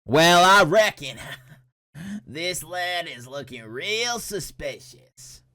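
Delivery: slow, hammed-up Westerner drawl; Westerner accent. Streamer playing a Westerner judging the friend, slow mock Western accent. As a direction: put on a badly faked Western accent, stretched out. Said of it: "as us Westerners would say".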